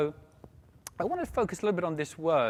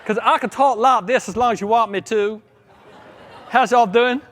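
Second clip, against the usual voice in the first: playful put-on American accent, louder; fake American accent; 5 semitones higher, 9 dB louder than usual. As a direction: tease the crowd in a mock American accent. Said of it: "when you talk in an American accent".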